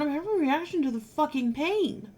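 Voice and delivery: high, angry swearing; swearing in pain. Retold or imitated. Patient answering the nurses in pained, high angry swearing. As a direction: spit it out like a furious sailor. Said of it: "I was speaking this way".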